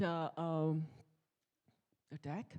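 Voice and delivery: ordinary talk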